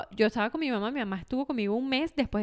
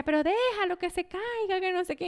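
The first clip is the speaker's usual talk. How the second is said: Pedro; 6 semitones higher than usual. Retold or imitated. Imitates Pedro's exasperated advice.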